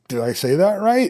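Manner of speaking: high, doubtful asking; a nervous student checking himself; unsure question. Retold or imitated. A student asking a German if he said it right, unsure. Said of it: "kinda look at the person like".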